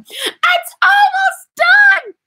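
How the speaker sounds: long, drawn-out high shout of joy; excited shout. Real, not acted. Excited squeal about her almost-finished product. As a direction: belt it out in delight, stretching every word.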